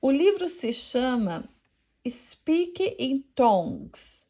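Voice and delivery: normal talking voice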